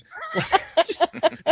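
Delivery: high cackling laugh; real burst of laughter. Real, not acted. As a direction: crack up, high-pitched. Cracking up at Boba Fett jab.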